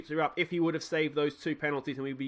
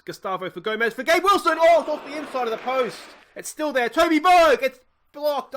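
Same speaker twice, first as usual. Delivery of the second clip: higher and louder, racing after every chance; frantic commentary; 9 semitones higher, 10 dB louder than usual. Real, not acted. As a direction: call the scramble like your heart's in your mouth. Near miss, frantic commentary.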